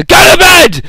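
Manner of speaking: high, explosive mock-singer yell; sudden shouted outburst. Comedian's song to get his daughter to sleep, sudden high yell. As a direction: imitate a comedian bellowing out of nowhere. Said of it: "and he just goes, he's going to sing".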